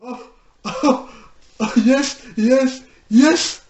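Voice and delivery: delighted, loud high "yes" bursts; excited cheering. Real, not acted. Vlogger reacting as the pony dodges the smack, excited cheering.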